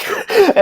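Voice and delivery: high laugh; a quick high laugh at the absurdity; burst of laughter. Real, not acted. Laughs at his own rejection.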